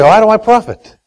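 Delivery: normal talking voice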